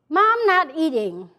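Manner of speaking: usual speaking voice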